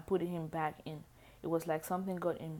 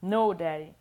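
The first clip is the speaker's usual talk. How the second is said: much louder protesting cry; 11 dB louder than usual. Acted. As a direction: cry it out after the harder spank. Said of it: "He spanked me harder".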